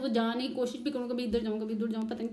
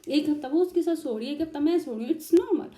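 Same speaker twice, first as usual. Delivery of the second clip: much higher, louder arguing back; heated retort; 7 semitones higher, 7 dB louder than usual. Retold or imitated. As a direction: push back hard in disbelief. बहस में खुद का जवाब. (बहुत ऊँची आवाज़ में बहस करते हुए जवाब)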